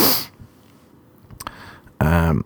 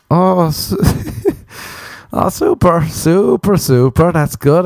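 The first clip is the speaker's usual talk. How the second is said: mock delight; 8 semitones higher, slower than usual.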